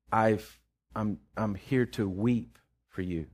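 soft, slow, grieving words from the heart; sorrowful. Retold or imitated. To the angry man in the dream, softly sorrowful. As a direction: quietly mourn for the one who wronged you. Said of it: "It's from the depths of my heart. There was sorrow".

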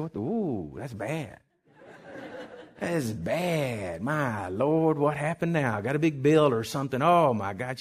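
low, quiet worried muttering; slow, sinking 'that is bad', mildly dreading; retold dread. Husband seeing his wife come back crying, hushed dread.

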